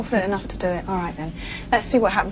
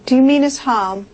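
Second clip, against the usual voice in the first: calling out; 4 semitones higher, 6 dB louder than usual. Real, not acted. Medium calling to spirit.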